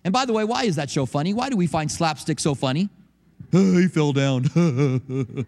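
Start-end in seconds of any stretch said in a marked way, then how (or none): 3.5-5.5 slow chuckling mock pity; laughing mock. Preacher mimics a viewer laughing at a fall, chuckling. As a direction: laugh it out like watching a pratfall. Mocking slapstick viewer reaction.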